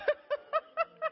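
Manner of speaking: high-pitched burst of laughter. Real, not acted. Preacher laughing at her own impression. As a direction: let a high giggle spill out.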